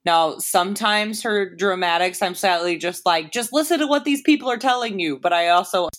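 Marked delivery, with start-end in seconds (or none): 3.3-5.2 exasperated. Retold or imitated. Frustrated with character.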